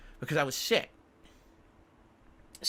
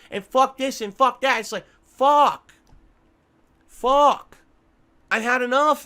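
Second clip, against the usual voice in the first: loud, higher furious yelling, real; furious shout; 6 semitones higher, 7 dB louder, slower than usual. Real, not acted. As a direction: scream it out, completely fed up. Rant peaking about being fed up.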